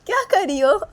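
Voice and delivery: high, loud words through big laughter; helpless, drawn-out mocking laughter; laughing hard. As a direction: crack up while asking in disbelief. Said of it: "बहुत ज़ोर ज़ोर से हंसने लगती".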